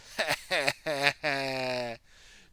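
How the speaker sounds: loud evil villain laugh. Acted. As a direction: cackle like a dark lord. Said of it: "Garmadon laughed".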